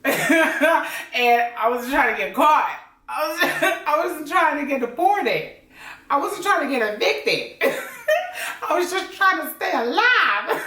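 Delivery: high-pitched, laughing through the words. Real, not acted. Joking to viewers about avoiding getting caught, laughing. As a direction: crack up while talking.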